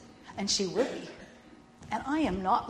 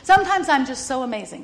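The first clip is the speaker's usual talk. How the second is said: put-on louder, proud little-girl boast; granddaughter's proud boast; 3 semitones higher, 10 dB louder than usual. Grandma retelling the toddler's proud boast. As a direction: mimic the toddler bragging. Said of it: "That's what she says".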